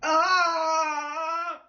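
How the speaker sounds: high, loud empty-lung scream; breathless scream. Shown as a demonstration. Vlogger trying the no-air scream trend, loud high scream. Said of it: "Let me try again".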